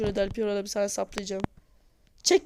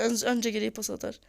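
normal talking voice

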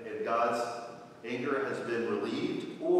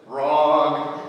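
mock Landon; 2 semitones higher, 10 dB louder than usual. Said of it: "And Landon's already laughing, like".

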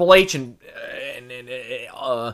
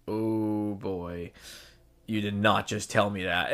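lower, softer, stunned disbelief as Roman; shocked Roman; 6 semitones lower, 10 dB quieter, slower than usual. Retold or imitated. Roman reacting to Cody's jab, low stunned disbelief. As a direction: mutter it low like a man who can't believe what he heard.

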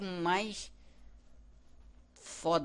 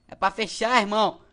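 loud, high-pitched panicked yell; frantic shout; 6 semitones higher, 11 dB louder than usual. Real, not acted. Frantic at unsealed door.

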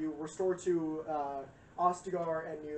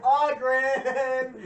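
loud, high exasperated "Oghren!"; hammed-up call out; mock yell; 7 semitones higher, 12 dB louder than usual. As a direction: shout his name in disbelief. Hero calls out Oghren.

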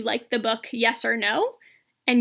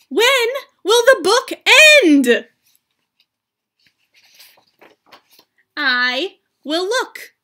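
lively, high character voices for a picture book; two story characters; 7 semitones higher, 5 dB louder, slower than usual. Acted. Teacher voicing Gerald and Piggie for students. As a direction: act out Gerald and Piggie for the class. Reads Elephant and Piggie aloud.

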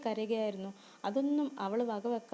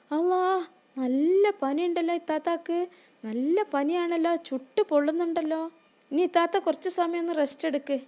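alarmed concern; 6 semitones higher, 7 dB louder than usual. Acted. Brother finds sister feverish.

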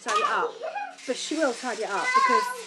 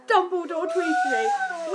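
much higher, slow delighted squeal; excited squeal; 7 dB louder, much slower than usual. Real, not acted. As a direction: squeal it out like a thrilled fan.

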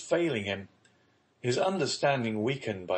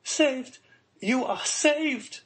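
put-on high-pitched young girl crying out with joy; joyful exclamation; 3 dB louder, much slower than usual. A narrator's reading. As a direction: burst out with the good news, drawn out. Young girl joyfully announces rescue.

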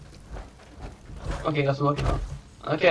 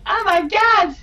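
much higher, loud shocked cry; shocked exclamation; 6 dB louder, slower than usual. Retold or imitated. Retelling the game characters reacting, in a loud, high, shocked cry. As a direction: shriek it like the crowd seeing his head go. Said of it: "everybody was like".

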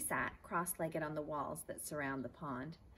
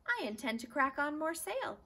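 bold eager plan; 11 semitones higher, 6 dB louder than usual. Acted. Stuart boasting plan.

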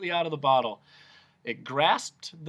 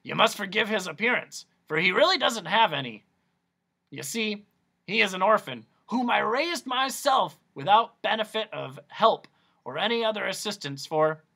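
higher, louder pompous doctor; raised showman voice; Doctor Discord; 7 semitones higher, 5 dB louder than usual. Acted. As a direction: lecture like a proud quack.

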